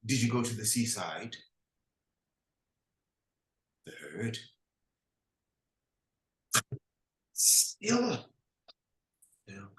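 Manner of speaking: a brooding leader questioning his servant; low commanding chieftain. Acted. Nabalac questioning Du Bois, low and brooding.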